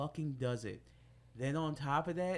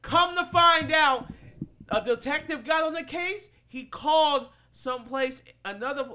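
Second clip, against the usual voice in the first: worked up; 10 semitones higher, 11 dB louder than usual. Real, not acted. Worked up retelling the scandal.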